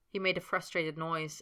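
ordinary delivery, nothing marked

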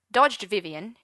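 ordinary delivery, nothing marked

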